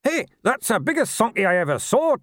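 high gnome chuckling; gleeful little gnome. A narrator's reading. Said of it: "chuckled Buggy".

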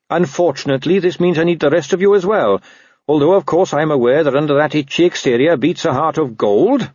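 lightly voiced earnest Carrot. Watchman asking a reluctant dog for help, lightly voiced and earnest.

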